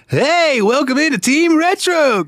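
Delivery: mock the hyper-energetic channel host; overly peppy youtuber. Mocking peppy YouTubers greeting their viewers, high and chirpy. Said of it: "like, one of those type of people".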